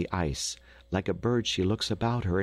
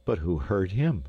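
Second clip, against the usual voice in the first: ordinary delivery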